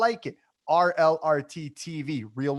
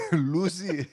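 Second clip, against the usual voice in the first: put-on Greek accent; 2 semitones higher than usual. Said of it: "you're doing it with the Greek accent".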